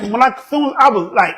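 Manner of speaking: ordinary talk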